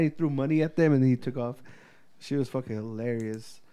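ordinary delivery

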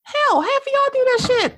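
high put-on voice tossing it at the crowd; mock exasperated retort. To critics of the president, a high mocking retort. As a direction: fire it back high and incredulous. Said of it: "I'm like".

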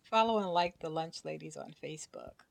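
ad read. Acted. Outro and ad read.

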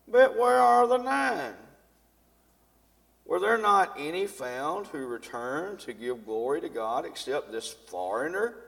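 loud, declaiming Bible reading; scripture reading. Acted. Pastor reading scripture aloud to the church, declaiming. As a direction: proclaim the passage to the congregation.

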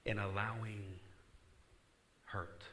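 slow emphatic. Shown as a demonstration. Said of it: "repeated fast".

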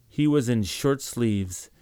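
ordinary delivery, nothing marked

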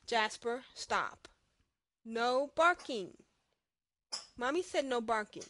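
loud firm scolding at the dog; hushing a pet. Real, not acted. Scolding her barking dog.

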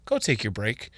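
low, soft imitation of a boss; retold quiet instruction. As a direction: quietly send an employee off. Boss gently sends her on break.

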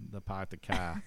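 put-on a light Boston accent, r's dropped in Pahk the Cah; lightly tossed-off Boston impression. He shows the one Boston phrase he can do in accent. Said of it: "I can do the".

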